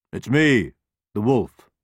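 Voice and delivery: a wolf at the door. A narrator's reading. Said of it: "And the wolf answered".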